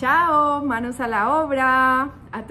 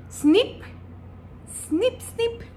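snip rising higher each time; scissors snip; 10 semitones higher, much slower than usual. Shown as a demonstration. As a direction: clip like scissors. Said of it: "Encontraste de pronto". (tijeras cortando, cada vez más agudo)

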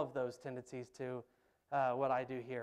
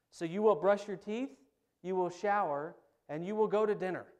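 louder, higher drill-sergeant commands; loud stern orders; 5 semitones higher, 8 dB louder than usual. Retold or imitated. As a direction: bark each order at the kids. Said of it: "he goes".

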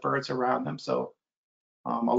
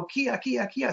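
hawk call; 9 semitones higher, slower than usual. Retold or imitated. Blue jay copying hawk call.

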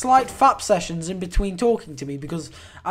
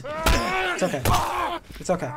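soothing; 10 semitones higher than usual. Pretend. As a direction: coo it like to a pet. Soothing NPC, sing-song.

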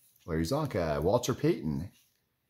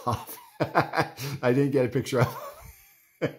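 higher, drawn-out words through laughter; real laughing; 7 semitones higher, 3 dB louder, slower than usual. Real, not acted. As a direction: laugh your way through the punchline. Laughs at his own punchline.

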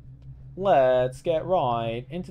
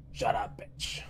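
whispered, softer, lightly voiced tough-guy dismissal; imitating The Rock; 4 semitones lower, 7 dB quieter, slower than usual. Dwayne Johnson on the mic, a lightly voiced whispered put-down. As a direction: hiss it like a wrestler brushing someone off. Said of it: "He's like".

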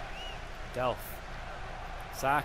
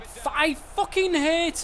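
slow, higher frustrated yell; angry outburst; 6 semitones higher, much slower than usual. Real, not acted. Gamer fuming at his player stopping, angry yelling. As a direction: rage at the game.

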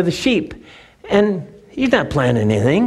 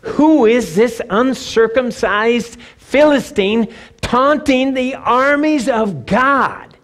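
indignant challenge; 8 semitones higher than usual. Acted. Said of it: "listen to his first response".

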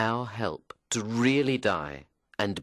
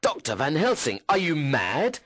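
raised, higher-pitched indignant challenge; angry outburst; 7 semitones higher, 5 dB louder than usual. A narrator's reading. Doctor confronts Van Helsing over his claim about Lucy, angry. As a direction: demand it angrily, voice climbing to the end. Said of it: "I was very angry, and I said".

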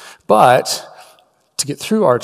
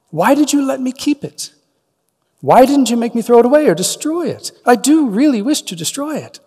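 much higher, pleading and distraught; Frodo crying out; 11 semitones higher, 4 dB louder than usual. Acted. Frodo pleading with Gandalf to destroy the ring, anguished. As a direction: cry out in anguish, pitch up. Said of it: "cried Frodo".

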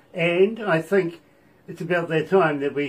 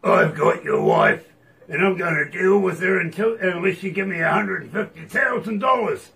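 gruff voice; 4 semitones higher, 7 dB louder than usual. Retold or imitated. Caller demanding ransom for a wife, in a mock gruff voice. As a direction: imitate a gruff kidnapper making loud demands. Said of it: "this gruff voice goes".

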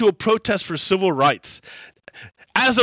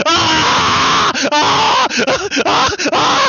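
howling laughter; 10 semitones higher, 4 dB louder, much slower than usual. Real, not acted.

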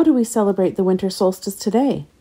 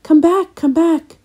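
high, raised-voice call; wailing plea; 10 semitones higher, 4 dB louder, slower than usual. A narrator's reading. Trees and plants beg the sun to return, wailing. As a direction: call out imploringly, pitch well up. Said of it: "wailed the trees and plants".

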